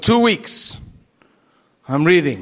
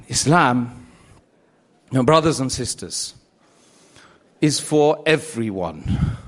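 solemn preaching; 6 semitones lower than usual. Acted. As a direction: let the final lesson sink in gently. Solemn closing exhortation.

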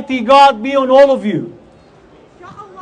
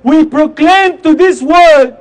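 fiery protest speech; rally the crowd with force; loud soapbox ranting; 4 semitones higher, 4 dB louder than usual. Acted.

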